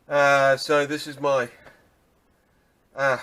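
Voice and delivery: panting after the effort; breathless, catching air between words; out of breath. Real, not acted. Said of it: "sorry, I'm out of breath doing that".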